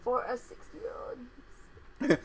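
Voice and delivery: slow soft whisper; real quiet whisper. Real, not acted. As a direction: murmur it under your breath. Whispered aside comment.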